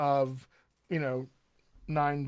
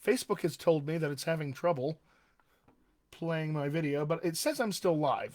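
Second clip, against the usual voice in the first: ordinary talk, nothing marked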